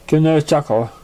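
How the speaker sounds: usual speaking voice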